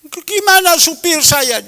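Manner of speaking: put-on elderly friend pleading about her driver; old friend's complaint. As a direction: play the aggrieved elderly friend. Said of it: "Dia ngomong".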